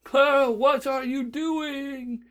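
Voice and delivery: high, slow call drifting away; fading voice. A narrator's reading. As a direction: call out as you fall away. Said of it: "came a fading voice as he dropped".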